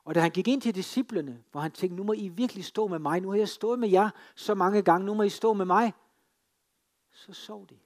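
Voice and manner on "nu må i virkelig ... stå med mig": raised, higher voice, pleading with the disciples; Jesus crying out to his disciples, loud and pleading. Acted. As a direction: beg them loudly to stand by you.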